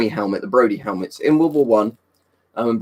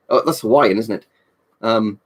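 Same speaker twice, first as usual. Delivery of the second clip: normal talking voice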